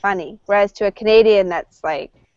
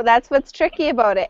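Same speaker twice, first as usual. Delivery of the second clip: ordinary talk, nothing marked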